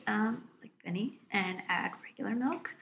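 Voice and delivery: whispered teasing aside; whispering. Real, not acted. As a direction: whisper it behind his back. Teasing aside about Benny.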